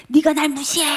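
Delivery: a parent scolding the teen who looks down on them, mock indignant; mock offended parent. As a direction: play the wounded parent snapping back, half in jest. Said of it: "이러지 마시고".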